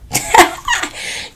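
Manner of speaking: sudden high-pitched, voiceless laugh; high breathy cackle. Real, not acted. Teasing that it wasn't over, high cackle. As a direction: burst into a squeaky cackle. Bursts out laughing before the twist.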